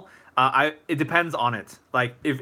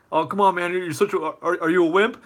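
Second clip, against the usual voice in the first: higher-pitched jeering bully; mock taunting; 5 semitones higher than usual. Imagined bully answering a refusal to fight, mock taunting. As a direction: egg him on as a pushy mock bully.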